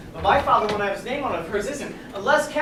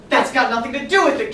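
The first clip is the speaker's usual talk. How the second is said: loud, raised-pitch angry protest; shouted retort; 4 semitones higher, 7 dB louder than usual. Acted. Catherine rebutting her fiancé about the invitation, shouting. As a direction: shout it down indignantly. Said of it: "Catherine shouted".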